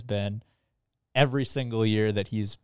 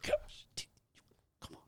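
whisper; 14 dB quieter than usual. Real, not acted. Whispered aside.

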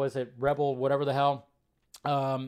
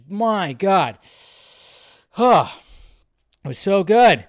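louder, amazed exclaiming; excited gush; 3 semitones higher, 10 dB louder, slower than usual. Real, not acted. Host gushing to viewers about flawless effects, excited. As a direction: blurt out how stunned you are.

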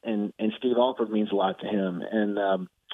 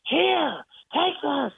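angry throw; much slower than usual. Retold or imitated. Coach throws the recorder at the reporter with a high angry bark. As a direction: shove it at him in fury. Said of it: "he throws it at me".

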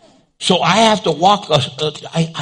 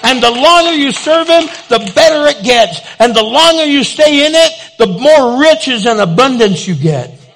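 full-force preaching; 5 semitones higher, 5 dB louder than usual. Real, not acted.

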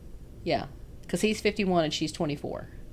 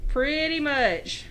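slow, higher Southern drawl; exaggerated Southern accent; 8 semitones higher, much slower than usual. Agreeing about having a horrible accent, hammed-up Southern drawl. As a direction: stretch both words in a thick Southern twang.

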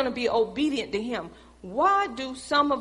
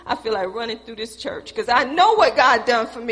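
loud, rejoicing, laughing at the end; joyful preaching; 6 dB louder than usual. Real, not acted. Preacher rejoicing at the congregation, loud and laughing. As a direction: let the joy burst out over the church.

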